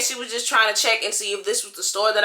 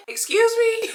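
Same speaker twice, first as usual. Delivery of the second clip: high, disbelieving excuse me with a laugh; can't believe he's leaving; incredulous excuse me; 10 semitones higher, slower than usual. Retold or imitated. To the officer who abandons her, high incredulous disbelief.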